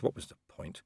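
whispered, resigned thought; sighing. A narrator's reading. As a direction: sigh it out, defeated. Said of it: "Vimes sighed".

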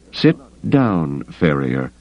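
usual speaking voice

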